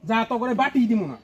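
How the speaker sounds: loud, dismissive scolding; angry. Shown as a demonstration. Teacher refusing to give the bowl back, angry. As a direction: send the student off with a loud rebuke. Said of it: "রাগ করেই থাকেন ভাই".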